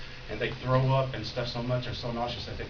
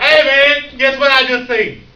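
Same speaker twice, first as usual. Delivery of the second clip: high, loud, bursting with news; excited news; 13 semitones higher, 20 dB louder, slower than usual. Retold or imitated. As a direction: blurt it out to the whole room. Convert tells everyone at restaurant.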